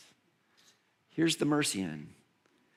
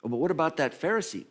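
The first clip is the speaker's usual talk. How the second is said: ordinary talk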